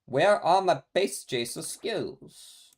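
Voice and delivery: slow, stretched-out plaintive complaint; lightly whiny, slow lament over missing skills; drawn-out whine. Real, not acted. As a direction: drag each word out in mock despair. Whining about missing game skills.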